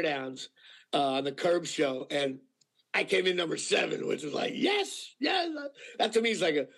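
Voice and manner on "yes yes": high-pitched celebratory yes; triumphant cheer. Retold or imitated. Actor celebrates making the top ten, triumphant cheer. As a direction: cheer the win, voice leaping up high. Retold triumph at ranking seventh.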